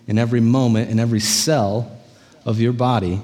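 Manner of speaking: ordinary talk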